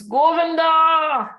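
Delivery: loud, high, long-drawn call; desperate drawn-out cry to God; crying out for help. Acted. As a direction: call the name out far, stretching the last vowel. Draupadi calls out to Krishna for rescue.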